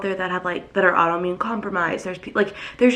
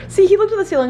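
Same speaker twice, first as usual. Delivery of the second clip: excited gushing; 12 semitones higher, 6 dB louder, faster than usual. Real, not acted. Gushing over her pet.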